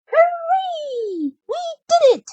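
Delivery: very high, gleeful cheer; little mice celebrating a win; squeaky high victory cheer. Acted. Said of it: "cheered the mice".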